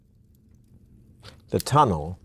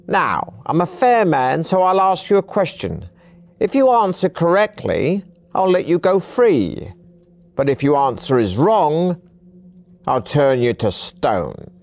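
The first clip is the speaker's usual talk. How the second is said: put-on high, emphatic old trickster laying down terms; high-pitched old man; 9 semitones higher, 5 dB louder than usual. A narrator's reading. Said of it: "In a high-pitched voice".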